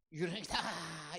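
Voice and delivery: mild mock scream, last syllable stretched; drawn-out demonstration of a scream; imitated cry of alarm. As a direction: act out a frightened cry, held back. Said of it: "이렇게 소리를 칩니다".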